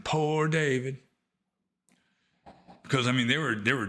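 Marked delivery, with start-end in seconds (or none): 0.0-1.0 lightly imitated friend, pitched higher; a higher, drawn-out mock-sympathetic coo; amused pity. Mother of the children teasing her suffering friend, amused pity. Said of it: "just kind of laugh and say".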